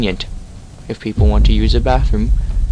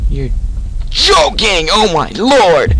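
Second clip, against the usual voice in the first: loud high shocked cry; shocked exclamation; 7 semitones higher, 4 dB louder, slower than usual. Real, not acted. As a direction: yell it out in disbelief. Sees a huge monster.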